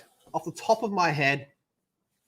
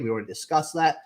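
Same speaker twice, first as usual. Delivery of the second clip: ordinary delivery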